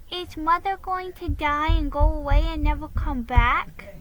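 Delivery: put-on high, worried small boy; a boy asking if Mother will die; little boy voice. A narrator's reading. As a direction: pitch it up like a frightened little boy. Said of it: "asked William".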